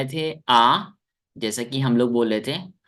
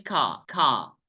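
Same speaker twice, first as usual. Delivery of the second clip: British English car; much slower than usual. Shown as a demonstration. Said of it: "वो लोग कार पूरा नहीं बोलते हैं".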